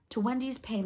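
plain reading voice

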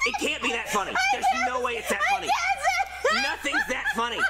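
high, laughing pleas; laughing exasperation. Acted. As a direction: beg through laughter. Pleading while teammate laughs.

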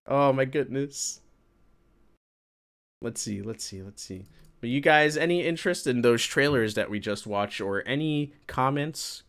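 ordinary delivery

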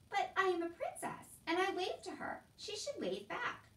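high, insistent princess voice; protesting princess. A narrator's reading. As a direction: insist on royal due. Princess protests to her father.

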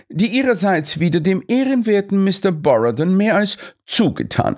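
high, sure explanation; lecturing Holmes. A narrator's reading. Holmes unmasks the plot to all, high and sure. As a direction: lay the scheme bare.

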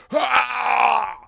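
high sharp cry of pain; high pained wail; retold wail. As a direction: yelp out like the crash hit. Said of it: "I let out this horrible, horrible wail".

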